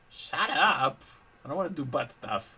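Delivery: loud angry yell; shouting. Acted. Hero yells at villain.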